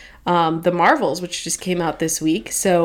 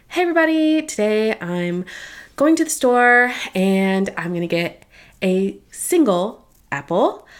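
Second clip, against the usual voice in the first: TikTok influencer voice; 2 semitones higher than usual. Retold or imitated. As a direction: parody a young TikTok vlogger, stretching words. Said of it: "doing that influencer voice that I hate".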